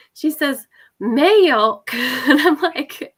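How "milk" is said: imitating a little one's drawn-out Southern accent, higher and louder; Southern toddler accent. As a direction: mimic a munchkin with a strong Southern twang. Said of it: "like, a Southern accent".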